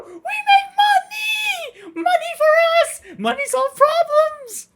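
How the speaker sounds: shrieked, very high, drawn-out cheers; ecstatic money screaming. Pretend. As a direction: scream it slowly at full pitch. Over-the-top money celebration.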